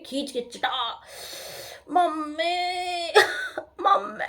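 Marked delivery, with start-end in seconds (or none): 1.9-4.2 high, slow crying wail of "mummy"; wailing for mummy. Retold or imitated. Slapped child wailing for her mother, high and slow. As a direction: sob out for mummy like a hurt child.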